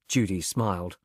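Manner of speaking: ordinary delivery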